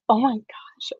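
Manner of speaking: real whispered gasp, fighting tears; whispered, overcome while reading; choked-up whisper. Real, not acted. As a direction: breathe it out, holding back crying. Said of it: "get it together over here".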